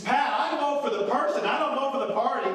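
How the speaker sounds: mock booming secret that everyone can hear; retired senator without his hearing aid, too loud; loud hard-of-hearing talk. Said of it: "he's talking like this".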